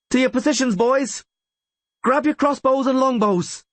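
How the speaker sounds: high, urgent commander's shout; shouted orders. A narrator's reading. Rayla orders the boys to grab weapons, shouting. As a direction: call the men to arms. Commander calls fighters to arms.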